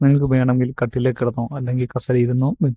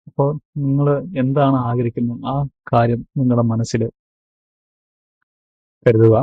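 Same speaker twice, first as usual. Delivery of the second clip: ordinary delivery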